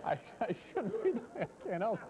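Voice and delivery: breaking up with laughter; giggly, barely holding it together; laughing. Real, not acted. Laughing at his own gag.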